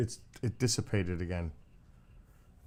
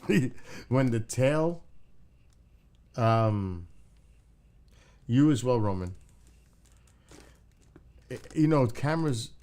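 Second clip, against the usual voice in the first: loud; 4 semitones higher, 7 dB louder than usual. Real, not acted. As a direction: raise the voice suddenly. Loud excited burst.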